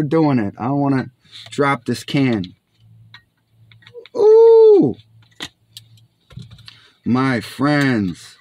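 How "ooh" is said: high squeal of delight; excited high ooh. Real, not acted. Opening the can, excited.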